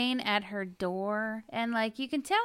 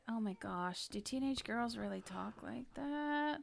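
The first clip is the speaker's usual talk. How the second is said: hushed disbelief, much softer; incredulous whisper; 16 dB quieter than usual. Retold or imitated. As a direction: breathe it out in shock. Own reaction, whispered.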